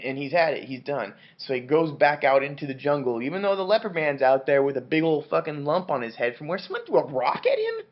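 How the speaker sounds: ordinary delivery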